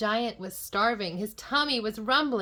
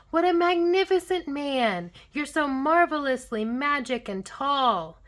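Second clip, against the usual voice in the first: put-on high, loud awestruck child; gasp, then gush with wonder; gushing admiration; 6 semitones higher, 7 dB louder than usual. A narrator's reading.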